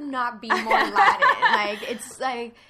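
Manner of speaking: loud laughing indignation; exasperated and laughing; indignant laugh. Real, not acted. Indignant about not looking Latin.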